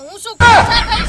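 loud, exasperated comic outburst; indignant yell. Acted. Farmer yells at Chiragbhai that he can't eat money, loud. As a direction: throw the question back loudly.

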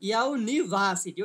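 "University" slow, split into parts, VA stressed most. Shown as a demonstration. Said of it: "ভি এর উপরে হচ্ছে stress আছে".

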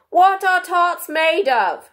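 high, loud, very slow royal question; grand kingly voice. A narrator's reading. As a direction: interrogate slowly and pompously. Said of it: "he said in a deep voice".

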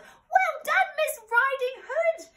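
very high, loud piglet cheer; jubilant yell. A narrator's reading. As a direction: yell congratulations as the squeaky pig. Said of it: "stood and yelled".

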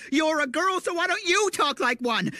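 high teasing comeback; mocking retort. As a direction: throw it back at her, mocking.

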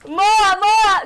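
loud, high repeated war cry; playful battle yell. Pretend. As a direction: shout each blow at your rival. Golpes finais na luta. (grito de guerra)

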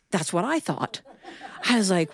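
ordinary talk, nothing marked

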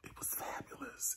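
soft whispered gush, nearly silent. Real, not acted. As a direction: breathe it out, moved. Whispered gushing after emotion.

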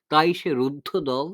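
ordinary delivery

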